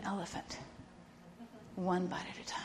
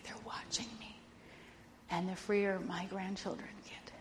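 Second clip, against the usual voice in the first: soft breathy whisper; hushed whisper; 5 dB quieter than usual. Real, not acted. Confiding to her friend in a whisper. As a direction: drop to an intimate whisper. Intimate confession to listener.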